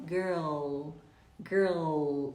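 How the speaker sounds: ordinary talk, nothing marked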